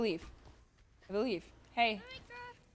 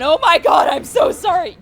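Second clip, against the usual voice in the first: loud, higher sorry yell; shouted apology; 7 semitones higher, 12 dB louder than usual. Real, not acted. Streamer shouting an apology to a chat member. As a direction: blurt out a big apology.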